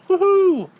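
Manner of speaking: high, loud gleeful Mario cheer; Mario woohoo. Retold or imitated. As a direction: whoop it like a thrilled Mario. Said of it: "he's looking up her skirt going".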